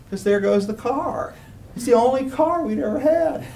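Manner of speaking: high, half-joking tearful lament; choked up. Retold or imitated. Grown man mourning the family car, playfully choked up. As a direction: lament it like a crying little kid. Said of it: "getting kinda choked up right now".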